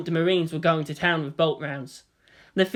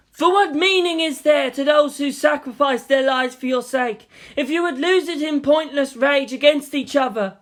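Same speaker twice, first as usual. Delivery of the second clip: commanding; 6 semitones higher, 5 dB louder than usual. Acted. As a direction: stop them with authority. Makoto commands crowd.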